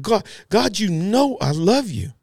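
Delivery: high-pitched, insistent pleading; emphatic Peter. Acted. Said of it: "He got emphatic".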